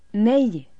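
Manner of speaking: loud, high screamed no; screaming no. A narrator's reading. Elin screaming no at her family. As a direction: scream the refusal. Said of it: "skriker Elin".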